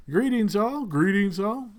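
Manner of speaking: a brief lilting hail to the crowd; Michael. Acted. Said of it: "you hear Michael's voice".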